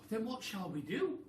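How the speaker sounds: high, loud, worried monkey cry; a monkey crying out in alarm. Acted. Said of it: "cried Mugglewump".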